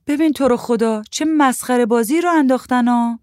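high, loud fed-up outburst; exasperated complaint. A narrator's reading. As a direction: complain loudly at the silly fuss. Aunt scolds about the mess. (غر زدن کلافه و بلند)

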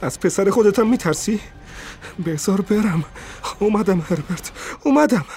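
high, urgent cries climbing to a shriek; crying out. A narrator's reading. Old woman struggles toward her son, crying out. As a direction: cry out while fighting to get free. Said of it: "خلاص کند فریاد زد".

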